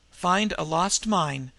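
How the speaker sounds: usual speaking voice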